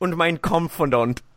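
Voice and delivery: high, hammed-up mock German; bad German accent. Joking with his cohost in hammed-up German accent. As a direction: blurt it like a cartoon German. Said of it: "terrible, terrible German".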